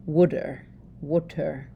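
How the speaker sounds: "water" as "wooder", with a short oo; Pennsylvania Dutch water. Shown as a demonstration. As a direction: repeat "water" with the vowel of wood. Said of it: "my Pennsylvania Dutch pronunciation of water".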